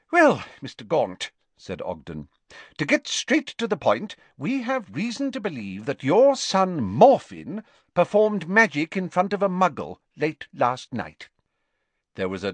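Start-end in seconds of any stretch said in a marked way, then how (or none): 0.1-11.3 higher, lilting official getting to the point; lightly voiced Ministry official. Official to a hostile father about his son, formal. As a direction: state the business formally, pitched a little up.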